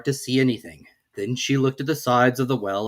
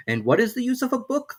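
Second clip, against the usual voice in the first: put-on young Alice; 12 semitones higher than usual. A narrator's reading. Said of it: "thought Alice".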